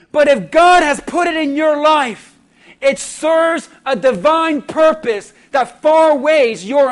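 stirring sermon delivery leaning on never; preaching. Acted. Pastor tells the congregation about loss, earnest preaching. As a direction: build the list with rising emphasis.

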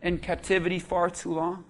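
soft, hushed pleading as God; tender voice of God. Acted. Preacher voicing God softly to the congregation, tender. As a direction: speak gently, calling them home. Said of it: "'Cause God says".

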